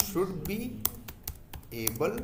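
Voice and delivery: word by word with long pauses; spaced-out dictation pace; slow dictation. Shown as a demonstration. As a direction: dictate each word so students can write. Dictating a feature line.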